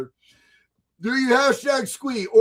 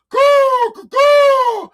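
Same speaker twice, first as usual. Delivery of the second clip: loud, high-pitched imitated eagle caw; bald eagle cry; 14 semitones higher, 7 dB louder, much slower than usual. Streamer playing America as a bald eagle, loud high cawing. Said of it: "like a bald eagle".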